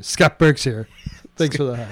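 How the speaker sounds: lightly voiced low catchphrase; Scott Burks. Shown as a demonstration. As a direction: demonstrate the sign-off low and understated. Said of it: "That's what I want you to change it to".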